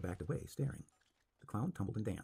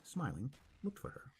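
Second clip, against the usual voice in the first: ordinary delivery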